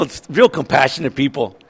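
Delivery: ordinary delivery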